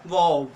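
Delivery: "वॉव" with an open vowel and no R; "वॉव" with silent R. Shown as a demonstration. Teacher models the British word, "वॉव" without any R. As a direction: drop the R in "वॉव". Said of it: "ई आर का वो क्या हो जाएगा".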